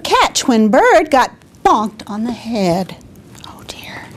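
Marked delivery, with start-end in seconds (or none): 3.5-4.1 whisper. Acted. Bird bonked on the head.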